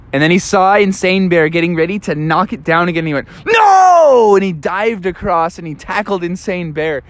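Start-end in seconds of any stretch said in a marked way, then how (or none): 3.5-4.4 a sudden high, loud cry of No!; shouted no. Acted. Bubby dives to stop bear.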